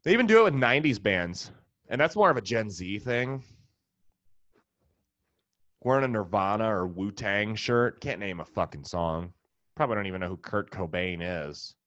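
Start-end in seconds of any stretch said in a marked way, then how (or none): none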